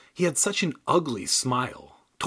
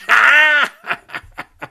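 very high, loud puppet laugh; wild shouted cackle; 12 dB louder than usual. Acted. Dummy howling at its own joke, loud cackle.

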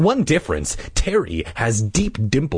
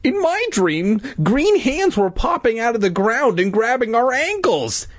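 put-on high boy voice; 13 semitones higher than usual. Boy character recounts dream.

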